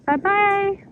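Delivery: high sing-song bye-bye; cooing goodbye. Real, not acted. Saying goodbye to George the gator, high cooing. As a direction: coo it high, like to a pet.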